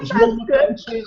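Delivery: put-on shrieky laughing English accent; laughing English accent, way up high. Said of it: "do an English accent".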